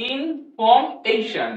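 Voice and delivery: drawn out very slowly: ইনফরমেশন, every syllable stretched; slow pronunciation demo. Shown as a demonstration. Teacher modelling ইনফরমেশন for students, very slow. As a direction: say ইনফরমেশন slowly so the students catch the shon ending. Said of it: "তাহলে উচ্চারণ কি হলো?".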